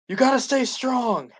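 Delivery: high, slow yell of encouragement; rallying cry to the creatures; yelled line. Retold or imitated. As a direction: shout it up high and drawn out. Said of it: "Just yells it".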